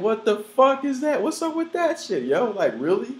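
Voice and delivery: high, loud incredulous shout; shocked what-the-fuck at the mother; outraged disbelief. Retold or imitated. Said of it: "And I'm like".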